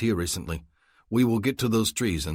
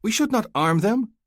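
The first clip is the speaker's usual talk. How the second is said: demanding; 6 dB louder than usual. A narrator's reading. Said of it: "Hassan demands".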